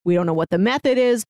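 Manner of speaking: usual speaking voice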